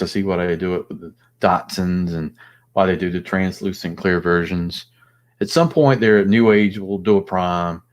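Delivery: ordinary talk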